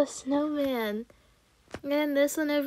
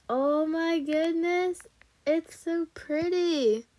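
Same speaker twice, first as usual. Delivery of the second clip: high, slow, drawn-out admiration; dreamy high-pitched gushing; awed gushing; 5 semitones higher, slower than usual. Real, not acted. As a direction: coo with delight, stretching the words. Admiring how pretty everything is.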